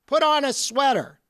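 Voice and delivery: mother yelling at her husband; retold yell. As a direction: call it out sharply. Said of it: "my mother yell at my father".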